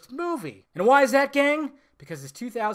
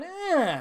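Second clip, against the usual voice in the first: high, drawn-out noise of doubt; unconvinced "eh"; 10 semitones higher than usual. Retold or imitated. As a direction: whine out a noncommittal "eh". Acts his doubt leaving the cinema.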